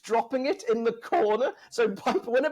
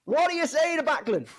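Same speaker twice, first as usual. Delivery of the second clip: higher, pushy referee badgering; Piper impression; 7 semitones higher than usual. Retelling Piper badgering Backlund in the hold. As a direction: hound him like Piper does. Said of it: "And, like, he goes".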